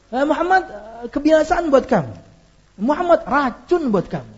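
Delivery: high sneering taunt at Muhammad; mocking curse. Mocking curse voiced by preacher.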